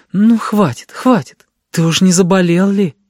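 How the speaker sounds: high, concerned coaxing; anxious soothing. A narrator's reading. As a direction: hush a crying child with worry. Aunt soothes crying boy anxiously. (успокаивая, с тревогой)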